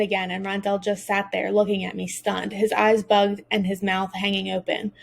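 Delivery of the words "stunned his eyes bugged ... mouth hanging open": usual speaking voice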